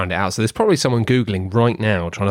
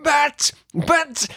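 mock dismay. Rival realises his undoing.

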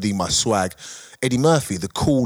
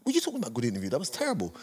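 quietly indignant retort; vexed disbelief; 11 dB quieter, much faster than usual. Retold or imitated. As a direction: protest under your breath in disbelief. Retelling his vexed backstage reply.

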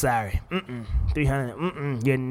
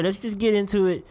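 usual speaking voice

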